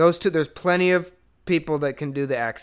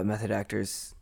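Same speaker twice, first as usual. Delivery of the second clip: ordinary talk, nothing marked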